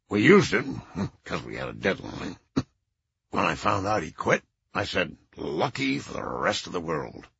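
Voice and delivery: put-on low, stuffed-up old man; low congested voice. Lanny's voice continues.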